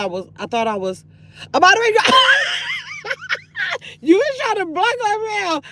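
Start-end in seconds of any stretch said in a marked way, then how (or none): 1.5-5.6 high shrieking laugh over the words. Real, not acted. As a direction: burst out laughing at yourself. Laughs at own mistake.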